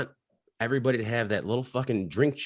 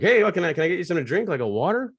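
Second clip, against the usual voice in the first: higher, louder, overly helpful; fussy bartender; 8 semitones higher, 3 dB louder, faster than usual. Retold or imitated. Bartender Nate fussing over a fallen old man, high. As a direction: fuss over the old guy with a raised voice. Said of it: "And Nate's like".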